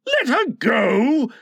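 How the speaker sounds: high, drawn-out outrage; incredulous cry. A narrator's reading. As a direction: cry it slowly in disbelief. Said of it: "cried Hook".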